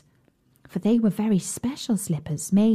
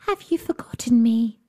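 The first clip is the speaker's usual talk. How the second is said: whisper; 3 semitones higher than usual. Acted. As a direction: whisper it secretly. Cat whispers to princess.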